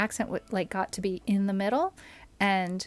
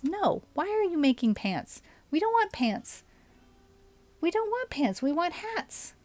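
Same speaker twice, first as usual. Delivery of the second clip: much higher, whiny protest; exasperated whine; 8 semitones higher than usual. Real, not acted. Streamer scolding colonist for making pants, high exasperated whine. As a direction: whine like a fed-up boss at a worker.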